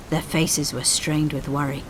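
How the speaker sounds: ordinary delivery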